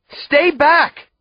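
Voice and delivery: loud, high warning shout. A narrator's reading. Brother warning his sister, loud shout. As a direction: stop her with a shout. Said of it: "I shout".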